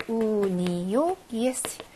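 우니요 drawn out syllable by syllable; slow model pronunciation. Shown as a demonstration. Teacher giving "to her" in Russian, slow model pronunciation. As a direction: say 우니요 slowly, holding each vowel.

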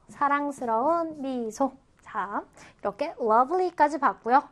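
usual speaking voice

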